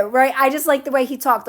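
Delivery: ordinary talk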